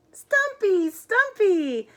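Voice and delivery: high, loud joyful cry, each name drawn out; overjoyed shout. Acted. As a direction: squeal the name in delight. Bat sees lost friend, overjoyed.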